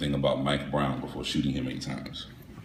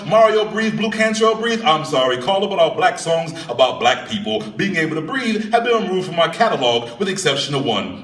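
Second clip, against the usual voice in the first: ordinary talk, nothing marked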